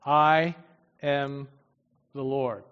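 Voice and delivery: I, am, the Lord, each word set apart by long pauses; slow pauses. Shown as a demonstration. Preacher spells out God's words for the congregation, each word separate. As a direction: let every word land alone: I... am... the Lord. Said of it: "he begins by saying".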